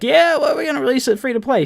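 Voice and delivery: high, fast mock corporate brush-off; hammed-up company spokesman, high and quick. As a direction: squeak it out like a smug company. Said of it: "if a company is gonna be like".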